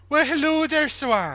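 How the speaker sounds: high, lilting Welsh villager voice; mock Welsh accent. Villager greeting a neighbour at the door, mock Welsh accent. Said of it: "Such a good Welsh accent".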